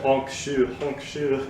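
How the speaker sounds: snoring sound, honk then shoo; fake snore honk shoe. Shown as a demonstration. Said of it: "fake snore".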